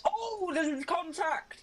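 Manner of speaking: long, high "oh" into the call; excited commentary call. Real, not acted. Commentator seeing two cars touch, excited. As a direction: stretch it out high as cars collide.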